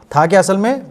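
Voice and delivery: ordinary delivery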